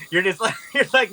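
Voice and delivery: ordinary talk, nothing marked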